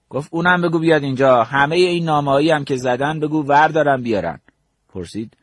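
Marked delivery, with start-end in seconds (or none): 0.3-4.4 raised, higher voice issuing orders; commanding. Retold or imitated. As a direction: fire off orders as an irked commander. Commander orders everyone summoned. (دستور دادن با صدای بلند)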